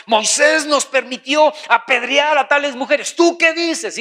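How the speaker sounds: high-pitched, insistent challenge; challenging. Acted. Accusers putting Jesus to the test over the woman, challenging.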